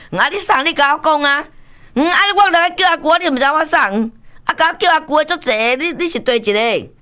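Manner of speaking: very high, insistent retort, hammed up; mock indignant challenge. Aunt quizzing an unknown caller on the phone, mock indignant. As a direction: pitch it way up as a played-up challenge.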